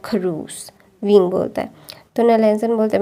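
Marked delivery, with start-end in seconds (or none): 0.0-0.7 a low, soft grumble under the breath; muttered insult. Retold or imitated. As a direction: mutter "खरूस" so he barely hears it. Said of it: "मैंने सुना।". (धीमे से बड़बड़ाकर)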